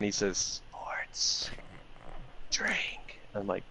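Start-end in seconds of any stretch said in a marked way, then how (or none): 0.7-3.2 imitating a faint, drawn-out whisper; hushed, barely audible mimicry of a friend. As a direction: whisper each word separately. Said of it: "And he whispers it again".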